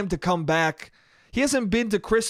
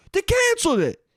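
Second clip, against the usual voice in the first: mock indignant shout; 14 semitones higher than usual. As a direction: declare it like outrage. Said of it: "He goes".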